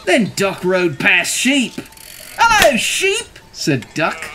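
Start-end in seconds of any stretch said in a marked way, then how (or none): 2.4-3.4 high, drawn-out greeting; Duck's cheery call. A narrator's reading. Duck greeting Cow from the bike, high drawn-out call.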